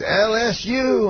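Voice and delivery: slow, high mimicked crowd-baiting call; Bill Cosby. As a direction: stretch each letter in a high impression. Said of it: "And then he goes".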